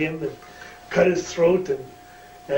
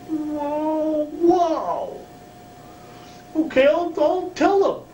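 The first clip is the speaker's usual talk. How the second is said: very high, eager voice; Carl's voice; 12 semitones higher, slower than usual. Retold or imitated. Carl insisting to Kerry he wants to, very high. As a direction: pitch it way up, keen to please.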